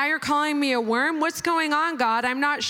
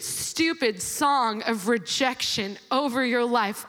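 usual speaking voice

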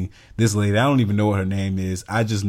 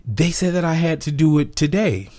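higher, whiny customer voice; mock entitled customer; 8 semitones higher than usual. Imitating the pushy customer demanding it today. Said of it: "she was like".

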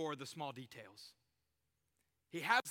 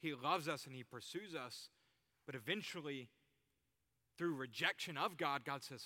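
ordinary talk, nothing marked

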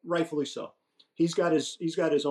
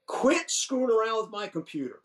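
annoyed yell; 9 semitones higher than usual. Retold or imitated. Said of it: "I'm getting pissed and I go".

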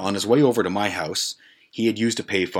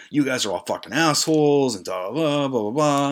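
raised-pitch imitation of an angry tirade; mock insulting rant; 7 semitones higher than usual. Imitating the host insulting his guests, mock indignant rant. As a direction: rattle off insults, trailing into blahs. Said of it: "just starts to insult us".

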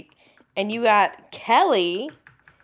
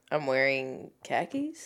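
low, quiet Boston-accent impression; Jake; 8 semitones lower, 8 dB quieter than usual. Co-host imagining the contestant's line, lightly put-on Boston accent. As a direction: imitate a Boston man, low and soft. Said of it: "In his Boston accent".

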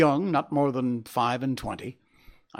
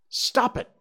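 high, urgent outcry; sharp cry; 11 semitones higher than usual. A narrator's reading. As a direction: cut in high and tense, like a doctor alarmed. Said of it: "I cried".

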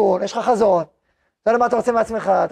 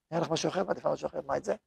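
hushed hesitant woman; 5 semitones lower, 12 dB quieter, faster than usual. Retold or imitated. Woman voices soft preferences.

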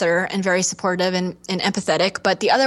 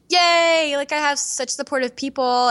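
mock cheer; 6 semitones higher than usual. Retold own cheerful reaction.

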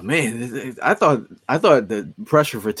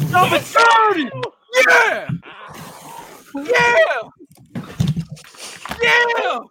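celebratory yelling; much slower than usual. Real, not acted. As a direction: cheer each one at full voice. Celebrating the draft pick.

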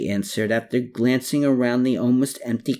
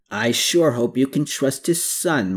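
usual speaking voice

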